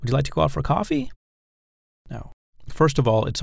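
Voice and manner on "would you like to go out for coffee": quick, higher question rising sharply on "coffee"; raised voice at end. Shown as a demonstration. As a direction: rush it and lift "coffee" high at the end. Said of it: "you shouldn't raise your voice at the end".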